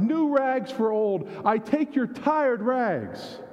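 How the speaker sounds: high, slow, drawn-out vendor call; ragman's call. Acted. Ragman offering new rags for old, slow high call. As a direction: stretch each word like a street cry. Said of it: "he cried".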